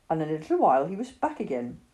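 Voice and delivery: usual speaking voice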